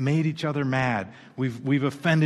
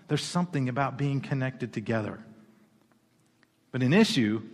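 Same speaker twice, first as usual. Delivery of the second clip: ordinary talk, nothing marked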